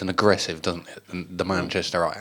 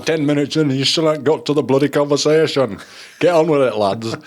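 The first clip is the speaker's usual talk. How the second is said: loud, higher mock-irate listener; complaining listener; 5 semitones higher, 4 dB louder than usual. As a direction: gripe loudly like an impatient fan. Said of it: "We do have complaints about that".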